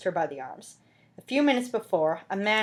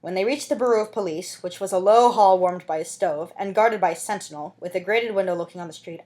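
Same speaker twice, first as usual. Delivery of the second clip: usual speaking voice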